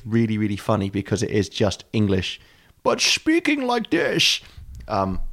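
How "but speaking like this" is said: high, slow, cartoonish Dutch accent; drawn-out mock-Dutch voice, pitched right up; silly Dutch accent. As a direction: put on a daft exaggerated Dutch accent. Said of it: "with a silly Dutch accent".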